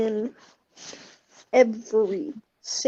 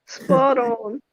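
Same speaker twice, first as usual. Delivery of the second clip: Sans impression. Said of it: "this is my Sans impression".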